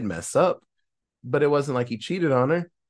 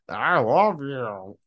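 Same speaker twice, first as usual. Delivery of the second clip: tender farewell; much slower than usual. Retold or imitated. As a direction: say it slowly, lovingly. Dying man hugs teddy bear.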